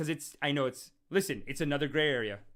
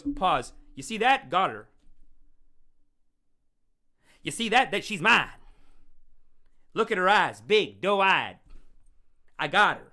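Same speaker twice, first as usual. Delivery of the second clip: louder, boastful mock coach voice; imitating a smug pickup coach; 3 semitones higher, 6 dB louder than usual. Mocking a pickup coach bragging to his seminar, loud and smug. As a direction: gloat like a seminar guru pausing the video. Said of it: "Then he goes".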